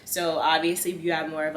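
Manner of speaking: usual speaking voice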